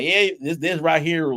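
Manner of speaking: put-on a mild, lightly done country accent; slipped into a country accent. Caller on a sales call drifting into a mild country accent. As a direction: let a Southern country accent creep in. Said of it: "have a country accent".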